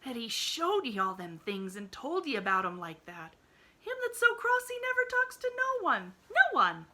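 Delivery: a gossipy maid in amazement; rustic maid Nancy. Acted. Maid marvels to the girl, loud and rustic.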